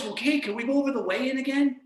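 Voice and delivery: high-pitched nagging inner critic; negative inner voice. Acted. Negative voice reopening the weigh-in, nagging. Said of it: "negative voice".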